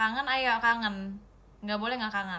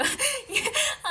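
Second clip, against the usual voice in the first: emotional laugh; 5 dB louder than usual. Real, not acted. Overwhelmed by the sweet message.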